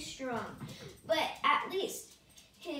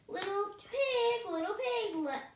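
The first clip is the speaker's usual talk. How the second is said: high, sly wolf calling at the door; big bad wolf voice; 6 semitones higher than usual. A narrator's reading. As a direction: coax like a hungry wolf. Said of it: "cried the wolf".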